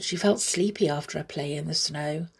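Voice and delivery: ordinary delivery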